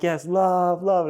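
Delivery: high, drawn-out mock cheer. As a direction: cheer like a crowd doing the wave. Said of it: "cheering me on".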